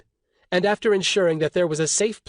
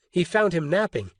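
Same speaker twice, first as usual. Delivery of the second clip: plain reading voice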